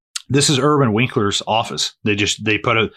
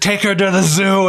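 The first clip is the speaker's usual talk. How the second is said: higher-pitched acted jibe; mock film put-down; 9 semitones higher, 3 dB louder than usual. Retelling a mean film line to a co-host, mocking jibe. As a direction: deliver the film's insult with relish, pitched up. Acting out film punchline, then laughs.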